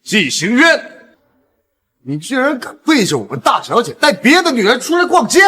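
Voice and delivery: high-pitched, outraged accusation; indignant scolding. Acted. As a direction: raise the voice high and accuse him. Subordinate angrily confronts a man. (气急地高声斥责)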